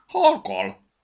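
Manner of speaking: explosive high sneeze; loud high sneeze. A narrator's reading. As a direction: sneeze so loud it carries to the nest. Sneeze heard from afar.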